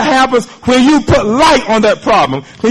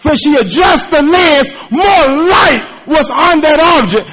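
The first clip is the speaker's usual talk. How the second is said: higher, louder joyful preaching; full-force preaching; 7 semitones higher, 4 dB louder than usual. Acted. Preacher telling the congregation the student's joy, full force. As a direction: lift the church with it.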